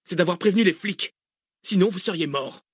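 dry, high-pitched rebuke; curt retort. A narrator's reading. Cécile crediting the call to the police, curt retort. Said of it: "répliqua sèchement Cécile".

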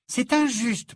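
high indignant objection; protesting. Acted. Hermione defends Tonks against Ron, protesting. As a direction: object sharply on a friend's behalf. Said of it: "protesta Hermione".

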